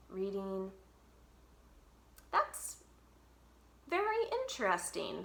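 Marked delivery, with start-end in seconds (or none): 2.3-5.2 teacher voice. A narrator's reading. Teacher musing over a pupil's letter, higher voice. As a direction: lift the pitch for the teacher's careful praise. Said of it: "says Missus Rao".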